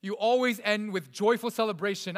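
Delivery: ordinary talk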